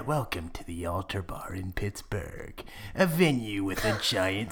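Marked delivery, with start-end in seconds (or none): 0.0-2.6 breathy spooky whisper. Pretend. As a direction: whisper it like a ghost story. Mock spooky venue intro.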